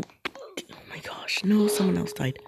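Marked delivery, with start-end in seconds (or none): 0.0-1.4 whispering. Real, not acted. Player sees someone die.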